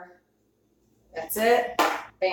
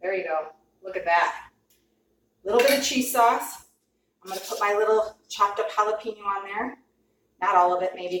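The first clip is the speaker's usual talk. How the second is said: ordinary talk, nothing marked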